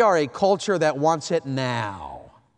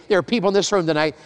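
ordinary talk

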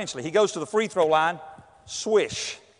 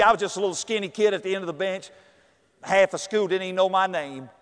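ordinary talk

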